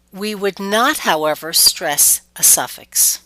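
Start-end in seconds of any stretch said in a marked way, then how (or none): none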